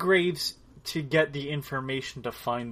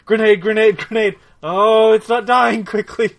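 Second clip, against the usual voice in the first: loud high panicked shouting; grenade warning yell; 7 semitones higher, 10 dB louder than usual. Real, not acted. Panicked play during monster fight.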